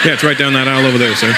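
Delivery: low, quick clerk's reply; lightly voiced American store clerk. Store clerk answers the Indian customer.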